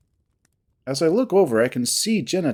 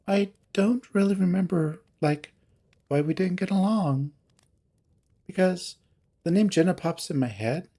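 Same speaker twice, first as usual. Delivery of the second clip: lightly voiced character, pitched higher; halting, higher voice making light of it; Heather, hesitant; 4 semitones higher than usual.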